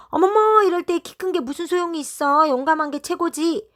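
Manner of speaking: high, loud shocked gasp; startled protest. A narrator's reading. Hansol's mom refusing to look, shrill startled protest. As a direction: squeal out the shock then argue.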